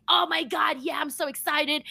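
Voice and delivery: imitated fan excitement; mock excitement. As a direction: put on gushing excitement you don't feel. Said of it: "I'm not so like".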